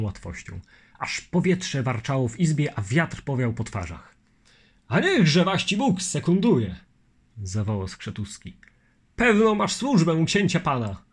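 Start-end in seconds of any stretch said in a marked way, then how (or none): 4.9-11.0 louder, higher admiring call; hearty exclamation. Acted. Skrzetuski admiring the Litwin, loud hearty call. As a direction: cheer the swordsman on.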